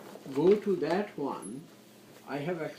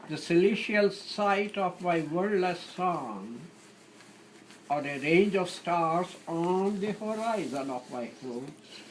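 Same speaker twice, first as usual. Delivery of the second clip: raised, declaimed verse; louder sonnet reading, pausing between lines; poetry recitation; 4 semitones higher, 4 dB louder than usual. Acted. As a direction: recite each line as a lofty image.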